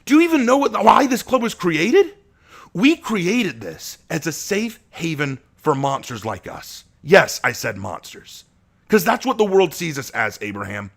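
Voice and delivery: impassioned outburst. Acted. Asher telling Abraham why the club exists, impassioned. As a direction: pour it out passionately.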